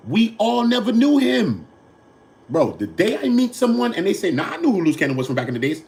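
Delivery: high, loud incredulous rant. Real, not acted. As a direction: rant it in disbelief. Incredulous rant about Luz fame.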